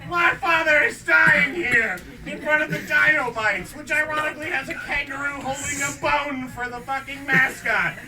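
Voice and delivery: high, loud, slowed outraged rant; frantic shout. Acted. Woman in cereal aisle ranting at the doctor, frantic shout. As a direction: yell it like your father's dying right here.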